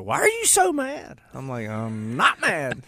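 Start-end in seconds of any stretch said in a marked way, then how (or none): 0.0-1.2 high-pitched imitation of someone asking; mimicked question, pitched way up; imitated questioner. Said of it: "Reagan's like". 1.7-2.8 drawn-out protest climbing in pitch; comically indignant self-imitation; mock angry denial. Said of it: "madder and angrier ... higher pitched".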